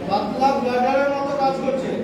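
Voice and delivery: ordinary talk, nothing marked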